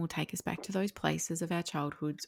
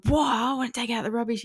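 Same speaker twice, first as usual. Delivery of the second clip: put-on higher, louder whiny kid protest; mock son pushing back; 6 semitones higher, 6 dB louder than usual. Mother imitating her son resisting the rubbish chore, whiny and loud. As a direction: play the sulky son refusing the chore. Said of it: "And he's like".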